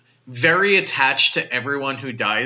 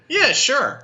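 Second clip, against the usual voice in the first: dismissive; 13 semitones higher, 3 dB louder than usual. Retold or imitated. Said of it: "He's like".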